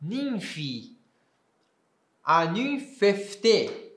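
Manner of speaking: ninfi ending in i, slow; Koine ninfi. Shown as a demonstration. Teacher giving the Koine form of the word. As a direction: say ninfi, then Aninfefte slowly. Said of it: "aqui eu posso ler em, no grego koiné".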